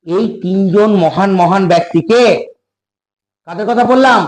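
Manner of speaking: normal talking voice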